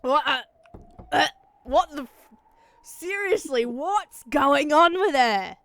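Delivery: puzzled outburst; irritated character voice. Acted. Character voice in the game.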